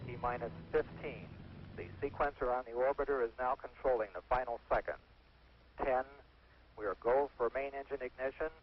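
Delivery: crackly radio transmission; tinny launch control radio; breaking up space radio. Real, not acted. Launch countdown over radio link.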